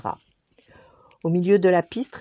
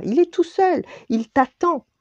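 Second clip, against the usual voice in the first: higher, bright coaxing voice; accordion's voice; 12 semitones higher, 4 dB louder than usual. Acted. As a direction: nudge her along excitedly. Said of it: "dit l'accordéon".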